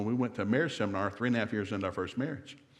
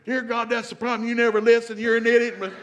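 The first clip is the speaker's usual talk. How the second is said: much higher and louder mock shouting; retold screaming fight; 12 semitones higher, 8 dB louder than usual. Husband and wife screaming on the drive home, hammed-up fury. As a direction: blow up at your spouse in the car. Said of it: "we were screaming at one another".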